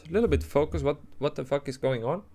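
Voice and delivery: normal talking voice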